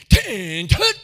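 loud mock drill-sergeant bark; drawn-out first word, explosive second; drill command. Helmeted woman calls boys to attention, mock military bark.

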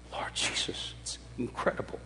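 hushed real wonder; awed whisper. Real, not acted. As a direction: breathe it out in awe to the Lord. Awed aside to Jesus.

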